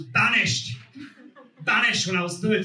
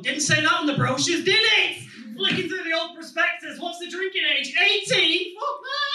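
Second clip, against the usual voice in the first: mock outrage; 11 semitones higher than usual. Comic outraged at American drinking age, high mock outrage. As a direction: rant it in comic disbelief.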